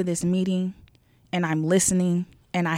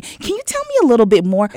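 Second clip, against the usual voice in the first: work voice; 4 semitones higher, 6 dB louder, faster than usual. Retold or imitated. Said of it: "work voice".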